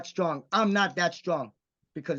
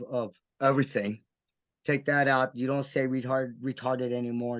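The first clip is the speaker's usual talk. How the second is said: usual speaking voice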